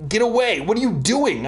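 high-pitched, lightly acted protest; mild wail. A narrator's reading. Sammy grabbed by Brent mid-race, mildly wailed protest. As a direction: wail it a little, starting high. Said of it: "I wailed".

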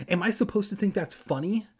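plain reading voice

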